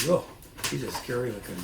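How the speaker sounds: ordinary delivery, nothing marked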